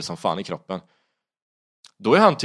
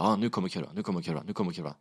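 soft repeated warning to yourself; muttered self-talk; 6 dB quieter, faster than usual. Retold or imitated. Rider riding blind counts on the coming curve, muttering. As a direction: mutter it under your breath, like a chant.

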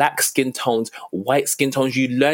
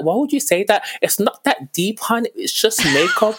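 exasperated retort; 9 semitones higher than usual. Retold or imitated. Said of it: "And I'd be like".

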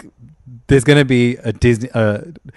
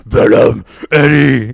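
Venom voice; 7 dB louder, much slower than usual. Retold or imitated. Quoting Venom movie lines.

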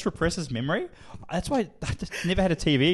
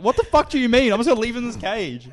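high, loud, incredulous protest; mock-furious kid arguing back; retold outrage; 8 semitones higher, 7 dB louder than usual. Acted quarrel with his dad.

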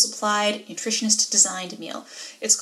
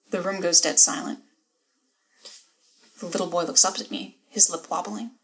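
normal talking voice

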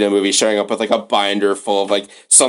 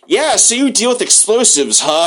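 high, prying mock-casual question; much higher voice, playing a fake-friendly new pal; nosy acquaintance; 10 semitones higher than usual. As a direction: fish for a favour with fake interest. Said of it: "and they're like".

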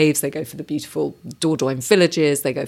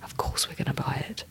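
whispering; 12 dB quieter than usual. Retold or imitated. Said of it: "And we just went".

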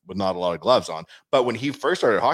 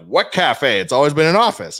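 louder, feigned-innocent protest; mock indignant denial; 6 semitones higher, 9 dB louder than usual. Joking to partners about disowning the cafe, mock indignant denial. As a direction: play it as loudly clueless.

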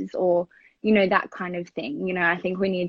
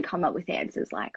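ordinary talk

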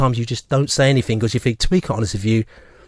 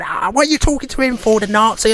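mock furious wife, much higher pitched; 9 semitones higher than usual. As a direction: snap it like the outraged wife at the bar. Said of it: "my wife was going rabid at him".

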